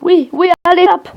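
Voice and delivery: yeah lancés plus haut et fort; excité. Real, not acted. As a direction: encourager en pleine partie. Combat dans le jeu. (excitation de joueur en combat)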